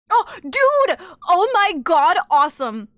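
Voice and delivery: high, loud outburst; thrilled stream reaction; excited exclamation. Real, not acted. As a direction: burst out, blown away.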